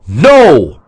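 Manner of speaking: loud shouted no; crowd's answer. A narrator's reading. Soldiers answering their god, shouted no. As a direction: shout back as one.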